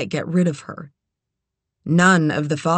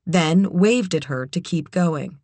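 ordinary delivery, nothing marked

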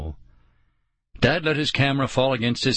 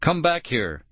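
calling out; 6 semitones higher than usual. A narrator's reading. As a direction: call someone back, restrained. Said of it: "Dad called".

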